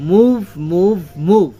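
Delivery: মুভ hit firmly at m and v; stress on MOVE. Shown as a demonstration. Teacher demonstrating stressed মুভ for pupils. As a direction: punch each মুভ. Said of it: "দুইটাকে stress দিয়ে আমরা বলেছি".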